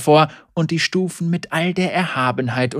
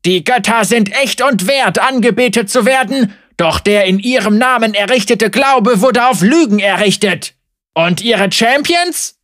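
loud preaching; 8 semitones higher, 9 dB louder than usual. Acted. Heretic preaching to crowd.

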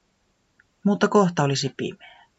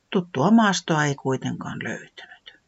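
ordinary delivery

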